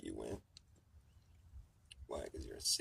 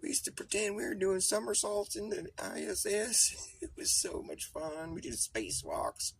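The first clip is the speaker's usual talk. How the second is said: put-on high, louder doddering old man reminiscing; senile old astronaut; 5 dB louder than usual. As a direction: ramble like a grandpa spilling secrets. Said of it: "It's gonna be all like".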